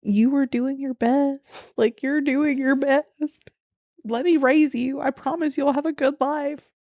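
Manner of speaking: put-on gentle comforting voice to a sad boy. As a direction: soothe a hurt kid slowly. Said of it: "hold him and be like".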